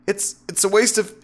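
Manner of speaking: high, faltering protest; flustered stammering. A narrator's reading. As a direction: stutter as resolve gives way. Protest breaks down under seduction.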